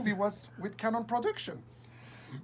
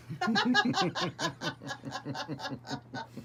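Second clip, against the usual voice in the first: long laugh; 8 semitones higher than usual. Real, not acted. Laughing at memory.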